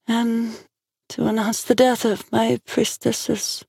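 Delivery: high, slow, solemn priestess voice; sorrowful oracle. A narrator's reading. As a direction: announce the deaths slowly, as a grieving oracle. Oracle mourns, head bowed sadly.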